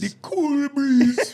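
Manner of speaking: very high, loud, slow cartoonish Jamaican with a laugh; Jamaican accent. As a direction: stretch "cool breeze" like a cartoon Jamaican. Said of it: "the Jamaican voice".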